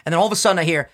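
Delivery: ordinary talk, nothing marked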